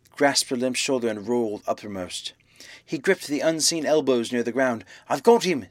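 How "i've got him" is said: loud, high cry; screamed out. A narrator's reading. Man grappling an unseen attacker, raised urgent shout. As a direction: shout it out mid-struggle. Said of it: "screamed Kemp".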